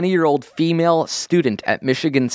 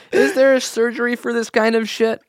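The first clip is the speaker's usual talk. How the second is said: very high-pitched comic voice; pitched way up for a joke; squeaky silly voice; 13 semitones higher than usual. Pretend.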